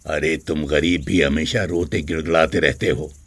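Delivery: low, scornful master's voice; a hint of a haughty rich man; arrogant rich master. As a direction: sneer down at the servant. Arrogant master scolds begging servant.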